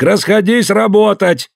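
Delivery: high slow yelled order; shouting. Acted. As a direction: bellow at the crowd to disperse. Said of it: "сорвался на крик".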